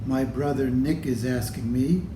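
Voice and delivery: ordinary delivery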